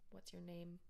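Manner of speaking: no upward lift at the end; deadpan question with a dropping end; flat falling question. Shown as a demonstration. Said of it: "an upwards inflection".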